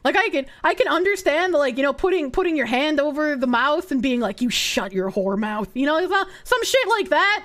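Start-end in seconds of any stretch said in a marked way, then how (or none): 4.4-5.7 lower, mock dominant order; low, teasing command. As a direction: play at hushing a lover firmly. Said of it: "not necessarily in that tone".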